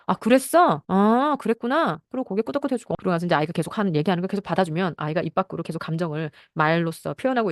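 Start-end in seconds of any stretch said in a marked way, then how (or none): 0.1-2.0 mock-parent listening, louder and higher, drawn-out at the end; empathetic acknowledgement. Parent responding to a child's venting, empathetic. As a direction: act out feeling along with a child, stretching the reply. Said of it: "그때도 감정으로".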